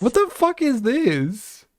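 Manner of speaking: high, slow, incredulous; shocked disbelief. Real, not acted. Streamer still reeling at the reading, slow incredulous disbelief. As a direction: drag it out in utter disbelief. Reacting to explicit book quote.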